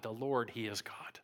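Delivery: hushed whispered worship; whispered awe. Retold or imitated. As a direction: whisper it as if overwhelmed. People fall on faces, proclaim.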